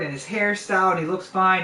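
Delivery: ordinary talk, nothing marked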